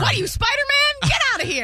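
high, teasing disbelief; a playful friend ribbing a would-be hero; mock scolding. Real, not acted. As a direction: tease him for playing hero.

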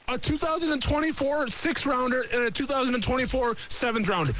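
high-pitched, escalating disbelief; agitated, rising alarm. Real, not acted. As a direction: voice climbing as the trades pile up. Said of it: "I'm trying to remain calm because the heart rate".

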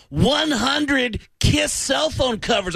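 high, slow, shouting rocker voice. Retold or imitated. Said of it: "And he said".

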